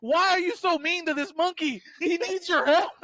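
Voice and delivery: high, loud, slow scolding; hammed-up Mothra scolding Godzilla. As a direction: tear into him like an angry mom.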